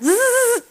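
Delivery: high mock electric buzz; a high zzz like a live wire; jokey high electric zap sound. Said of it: "poked him in the sides and went".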